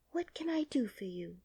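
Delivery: gentle whispered offer of help; tender hushed question; soft whisper. Acted. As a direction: whisper it kindly to the sad man. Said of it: "she inquired softly".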